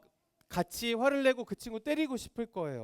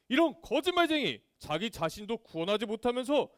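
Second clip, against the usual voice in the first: high, loud jeering heckler; acted sneering denunciation; scornful accusation; 4 semitones higher, 4 dB louder than usual. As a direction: hurl accusations, building to a shouted insult. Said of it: "이러면서 막 예수님을 손가락질했어요".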